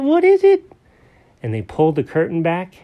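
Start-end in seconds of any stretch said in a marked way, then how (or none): 0.0-0.6 eager repeat. Acted. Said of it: "Jenkins goes".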